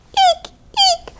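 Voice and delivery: tiny, very high double squeak; mouse squeak. A narrator's reading. Little mice woken in the stable, high squeaks. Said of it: "squeaked the scampering mice".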